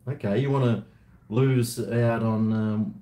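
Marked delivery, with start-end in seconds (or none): none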